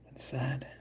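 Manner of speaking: soft whisper; whispered sad. Real, not acted. Streamer alone with no watchers, whispered. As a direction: sigh it out softly. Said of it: "I have no watchers now".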